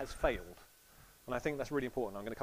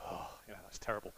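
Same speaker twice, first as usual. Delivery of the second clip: dismissive groan; 7 semitones lower, 9 dB quieter, faster than usual. Retold or imitated. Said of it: "some people go".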